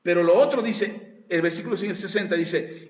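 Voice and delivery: ordinary talk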